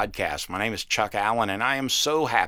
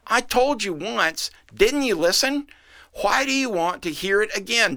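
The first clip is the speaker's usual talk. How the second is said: exasperated; 8 semitones higher than usual. A narrator's reading. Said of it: "the man exclaimed".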